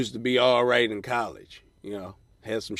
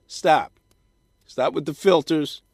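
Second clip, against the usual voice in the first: louder, higher scolding; loud stop; 5 semitones higher, 7 dB louder than usual. Real, not acted. Comic scolding women about Snapchat filters, loud. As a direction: bark it at the listeners.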